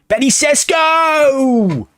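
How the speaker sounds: high, stretched-out cheer of the name; excited goal call. Real, not acted. Gamer hailing his striker's goal with a long high cheer. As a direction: call the scorer's name like a commentator. Player scores, gamer celebrates.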